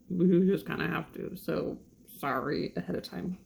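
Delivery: ordinary delivery, nothing marked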